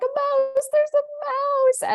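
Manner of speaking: high, loud screamed alarm; panicked scream. Retold or imitated. Wife screaming for her husband about a mouse, high panicked shriek. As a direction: shriek it, stretching out the last word. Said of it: "And I was screaming".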